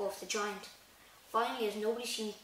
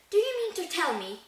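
raised, louder angry demand; angry growl; 9 semitones higher, 6 dB louder than usual. A narrator's reading. Hagrid confronting the Dursleys, growling angrily. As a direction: demand an answer in outrage. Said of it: "he growled at the Dursleys".

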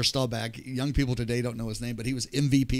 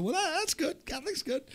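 much higher, softer mock-approving dad; dad's voice; 5 dB quieter than usual. Son retells dad approving Catholicism in a much higher, softer mock voice. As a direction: put on a high, soft, easygoing dad voice.

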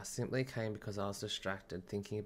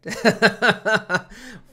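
high, loud laugh; a bright burst of laughter; 12 semitones higher, 17 dB louder than usual. Real, not acted. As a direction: laugh out at the surprise. Laughs at a knock from yaya.

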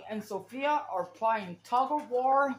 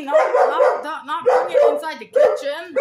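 much louder, firm scolding; 11 dB louder than usual. Real, not acted. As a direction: stop the dog cold with a loud scold. Scolding dog going into kitchen.

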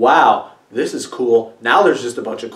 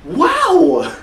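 wide-eyed tourist; 8 semitones higher than usual. Acted. Marveling at green screen backgrounds.

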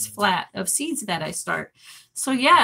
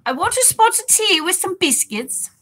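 high-pitched mock British accent; 9 semitones higher than usual. Streamer acting out a chat request, in a playful British accent. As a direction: put on a hammed-up British accent, pitched right up. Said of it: "In British accent".

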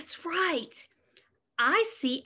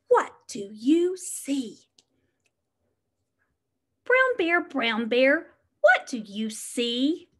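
put-on reading to children. Reads picture book aloud.

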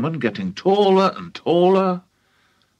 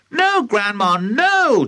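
calling back; 8 semitones higher, slower than usual. Acted. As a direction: shout no up to her. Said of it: "George called back".

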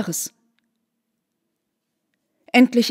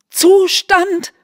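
much higher, louder outburst of disbelief; shocked cry; 10 semitones higher, 7 dB louder than usual. A narrator's reading. Mother hurls the word back at her daughter, shocked. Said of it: "rief die Mutter wieder".

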